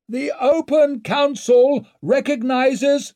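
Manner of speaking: high, slow, loud proclamation; drawn-out herald's call; announcer calling out. A narrator's reading. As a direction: announce it formally over a crowd. Announcer calling the hall to order.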